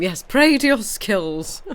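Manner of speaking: hammed-up fake piety; mock sermon. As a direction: proclaim it like a comic commandment. Jokes on her own misreading.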